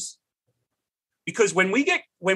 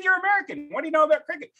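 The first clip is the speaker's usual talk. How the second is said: incredulous; 11 semitones higher, much faster than usual. Retold or imitated. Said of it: "I said".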